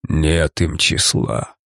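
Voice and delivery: low, slow rasping creature voice; hoarse monster wheeze. Acted. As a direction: drag it out like a dying beast. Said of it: "прохрипел в ответ монстр".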